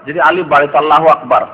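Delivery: ordinary talk, nothing marked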